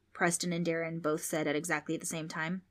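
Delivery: usual speaking voice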